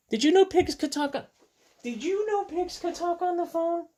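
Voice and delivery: high, squeaky mock astonishment; mock amazement. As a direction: marvel at it in a high voice. Mock amazement at talking pigs.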